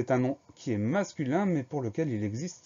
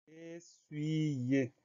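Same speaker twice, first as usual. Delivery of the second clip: slow, higher word with stretched syllables; drawn-out dictation; 4 semitones higher, 5 dB quieter than usual. Shown as a demonstration. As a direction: draw out each syllable for dictation. Dictée, mot annoncé lentement. (mot étiré pour la dictée)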